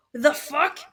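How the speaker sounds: mock outrage. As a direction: act out pissed-off disbelief. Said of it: "Florian's already pissed with them".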